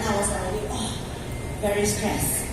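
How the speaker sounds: ordinary delivery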